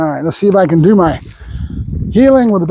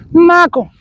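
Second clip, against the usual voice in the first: calling out; 10 semitones higher than usual. Real, not acted. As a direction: call him back from across the yard. Trainer calling dog across yard.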